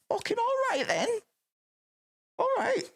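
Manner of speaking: high, held surprised acceptance; surprised concession. Real, not acted. Friend made his point, conceding in a high voice. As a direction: squeak out a startled all right.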